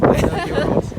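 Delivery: high squeaky teasing echo; squeaky mock voice. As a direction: squeak it back mockingly. Echoing friend's boast, squeaky mock.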